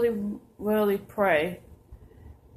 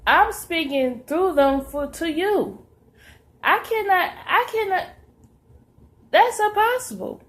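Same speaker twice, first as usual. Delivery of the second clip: voicing the Lord; 6 semitones higher, 4 dB louder than usual. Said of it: "And he says".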